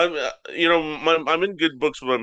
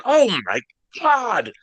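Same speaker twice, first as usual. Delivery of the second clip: amazed mom; 9 semitones higher, 4 dB louder, slower than usual. Retold or imitated. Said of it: "And she's like".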